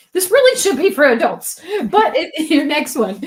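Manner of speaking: high and loud, laughing through it. Real, not acted. Host teasing the author, laughing. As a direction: laugh as you insist. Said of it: "laughing remark".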